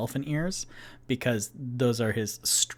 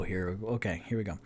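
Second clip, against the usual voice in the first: normal talking voice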